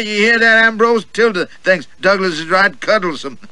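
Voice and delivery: high and loud bluster; a lecherous old goat crowing at his table; blustering old gentleman. Acted. Old gentleman teasing Tilda across the table, loud and high.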